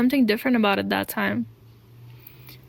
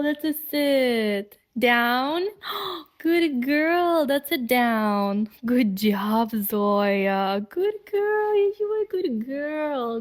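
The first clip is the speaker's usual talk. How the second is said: talking to a dog; 4 semitones higher, much slower than usual. Real, not acted. Praising her dog Zoya.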